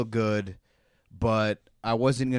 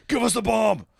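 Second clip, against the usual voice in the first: high, loud yelling interrogator; Kiefer Sutherland; 12 semitones higher, 4 dB louder than usual. Retold or imitated. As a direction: shout at the suspect for the bomb. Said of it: "give me your Kiefer Sutherland".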